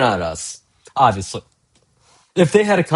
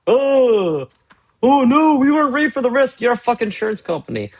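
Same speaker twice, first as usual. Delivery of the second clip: high-pitched mocking fake alarm; mock panic; 11 semitones higher than usual. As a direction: sneer at them in a shrill panicked voice. Mocking insurance company panic.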